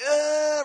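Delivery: high, strained gasp for air; hammed-up winded cry; mock winded gasp. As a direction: wheeze like the breath was knocked out. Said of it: "And I'm like".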